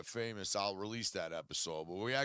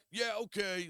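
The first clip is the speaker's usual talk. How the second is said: quoting Adam; 10 semitones higher, 4 dB louder than usual. Retold or imitated. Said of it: "Adam chirped in".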